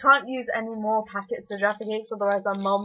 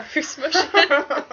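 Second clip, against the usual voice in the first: laughing; 6 semitones higher, 5 dB louder, much slower than usual. Real, not acted. Cheeks full, laughing at mess.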